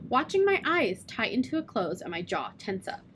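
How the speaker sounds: ordinary delivery, nothing marked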